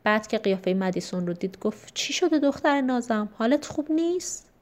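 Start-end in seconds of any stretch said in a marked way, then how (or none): 1.9-4.5 high-pitched, caring parental questions; tender concern. A narrator's reading. Father notices his daughter's troubled face, tenderly concerned. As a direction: coo with concern for a child.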